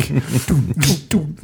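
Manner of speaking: quick, high "doom" hits; imitated kick drum. Host showing off the quick kick drum, imitated drum. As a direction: mimic tight drum hits with no sustain. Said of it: "It's so quick".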